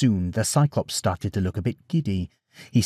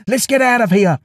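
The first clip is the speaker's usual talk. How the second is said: high, loud escape order; yelled; 10 semitones higher, 6 dB louder than usual. A narrator's reading. Odysseus orders the boat away, yelled. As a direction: shout it urgently to the crew. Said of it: "yelled Odysseus".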